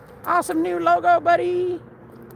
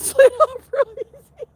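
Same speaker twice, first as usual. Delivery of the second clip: higher and louder, bursting into laughter; laughing; 9 semitones higher, 8 dB louder, slower than usual. Real, not acted. Streamer explains the Gore-Tex joke, breaking into loud laughter. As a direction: lose it on the punchline. Punchline broken by own laughter.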